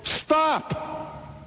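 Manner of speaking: sudden high cry, urgent; Paul cries out to stop the jailer. A narrator's reading. Urgent high shout. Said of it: "crying in a loud voice".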